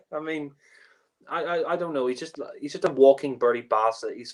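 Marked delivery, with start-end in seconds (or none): none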